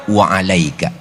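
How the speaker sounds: low, even "Wa alaika" said evenly; low calm reply. Retold or imitated. Prophet calmly answering the insult, low and even. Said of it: "balikin aja".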